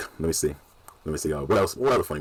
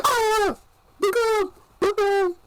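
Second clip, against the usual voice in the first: mock yelling; much slower than usual. Retelling his fright at touching the dead body, high mock screaming. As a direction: squeal a panicked warning in imitation. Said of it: "What you keep yelling for?".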